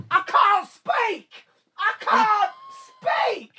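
high, strained shout of a man who lost his voice; hoarse commentator shouting. Retold or imitated. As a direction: squeeze out a cracked, losing-voice yell. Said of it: "this fella shouting".

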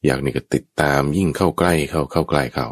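ordinary talk, nothing marked

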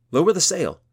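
loud, high urgent call. Acted. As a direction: shout the order over the wind. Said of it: "Gunhild called".

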